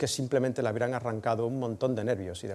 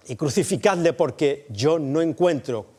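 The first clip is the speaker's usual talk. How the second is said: Pilate exasperated; 5 semitones higher, 8 dB louder than usual. Acted. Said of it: "Pilato les dijo".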